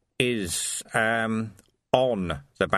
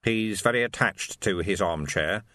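usual speaking voice

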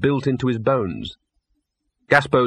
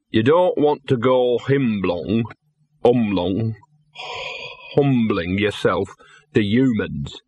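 slow, slurred little dog lecturing; tipsy dog stumbling over his words; drunk lecture; slower than usual. A narrator's reading. Gaspode lectures Laddie outside the pub, mildly drunk. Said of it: "in alcoholic perplexed disapproval".

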